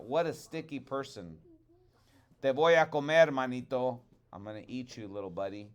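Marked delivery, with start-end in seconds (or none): 2.4-4.0 "Te voy a comer, manito" louder in Spanish; Spanish expression. Shown as a demonstration. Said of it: "some Spanish expressions".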